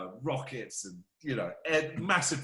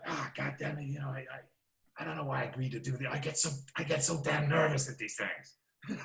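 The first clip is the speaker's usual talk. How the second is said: mild impression of a nervous actor, softer and rushed; nervous Joe Pesci; 4 dB quieter than usual. Lightly voiced Joe Pesci grumbling backstage about nerves. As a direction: mutter it like a jittery star.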